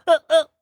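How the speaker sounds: quick high giggle imitation; a short high giggle; small Pee Wee laugh. Shown as a demonstration. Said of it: "or the".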